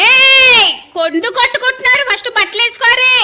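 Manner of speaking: very high, very loud yell; mother yelling at fighting sons to stop; furious shout. Real, not acted. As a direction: scream to break up the fight. Kids fighting, mother yells.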